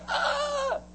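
high, thin mock-scared wail; acted fright, high pitched; frightened cry. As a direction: cry out in terror without shouting. Grown men scared half to death.

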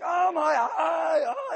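high, slow, drawn-out mock moan of pain; milk the hurt eye for sympathy; hammed-up wail. Pastor mocking himself to the congregation, hammed-up wail. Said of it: "But I'm like".

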